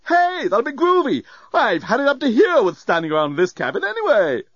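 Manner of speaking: high, exuberant, laid-back hipster robot; groovy robot. A narrator's reading. Lamp glad to leave the cabin, exuberant. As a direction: whoop with relief at being released.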